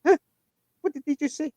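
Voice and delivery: high, confused "Huh? What did they just say?"; mock bewildered question; baffled huh. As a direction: imitate a lost listener, pitch shooting up. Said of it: "you're sitting there going".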